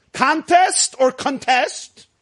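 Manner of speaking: "Contest" first as CON-test, then con-TEST; slow "Contest or contest", stress moving to second syllable; contrasted word stress. Shown as a demonstration. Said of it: "How do you pronounce?".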